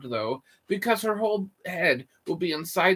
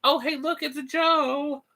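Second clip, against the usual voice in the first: high silly voice; 9 semitones higher than usual. Acted. Greeting viewer in high voice.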